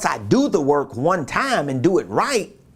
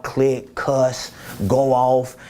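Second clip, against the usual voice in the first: usual speaking voice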